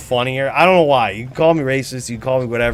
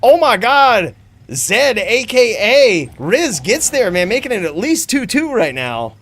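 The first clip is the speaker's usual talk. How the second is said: loud, higher-pitched fight hype; excited reaction; 8 semitones higher, 5 dB louder, slower than usual. Real, not acted. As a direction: cry out in amazement at the fight.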